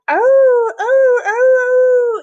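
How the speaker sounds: high, loud howling wolves; wolf howls. Acted. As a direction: howl like a distant wolf pack. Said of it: "in the background".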